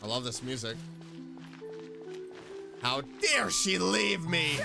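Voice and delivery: ordinary talk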